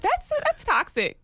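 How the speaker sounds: high, slow appalled voice; mock appalled. Wife mimicking her own shock at the family. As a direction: drag it out in mock horror. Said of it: "I was like".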